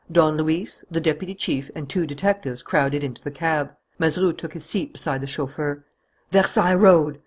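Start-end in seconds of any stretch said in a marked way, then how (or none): 6.3-7.2 loud roared destination. Acted. Don Luis roars the destination to the driver. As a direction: roar the order at the driver. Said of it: "roared Don Luis".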